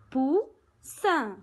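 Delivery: "Poussin" split in two, pous, then sin after a pause; syllable by syllable. Shown as a demonstration. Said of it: "Deux syllabes".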